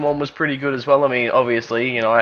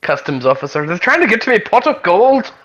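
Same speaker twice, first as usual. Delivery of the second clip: bad Irish accent; 5 semitones higher than usual. Shown as a demonstration. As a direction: do a deliberately bad Irish accent, lifting higher and livelier at the end. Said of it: "the stereotypical Irish voice".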